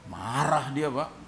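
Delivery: ordinary delivery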